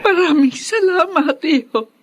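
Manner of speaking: thanks jumping high, half laugh half sob; grateful sob breaking into a laugh; laughing through tears. Acted.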